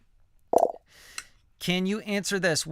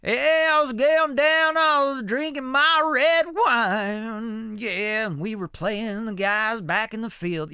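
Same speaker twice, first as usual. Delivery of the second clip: high, loud, drawn-out country singer twang; country accent; 7 semitones higher, 4 dB louder, slower than usual. Shown as a demonstration. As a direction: sing it out in a goofy put-on country accent. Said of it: "making that country accent".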